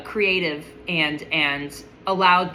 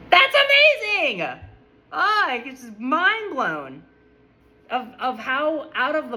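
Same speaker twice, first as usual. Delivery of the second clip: gushing amazement; 7 semitones higher than usual. Real, not acted. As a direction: marvel at it, high and breathless with wonder. Gushing about her boss.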